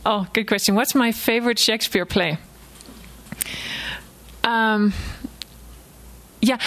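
usual speaking voice

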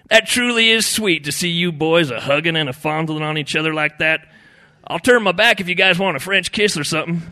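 high, loud teasing; mock them with sugary admiration; sarcastic. A narrator's reading. Fishing buddy ribs the hugging brothers, sarcastic. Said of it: "commenting sarcastically".

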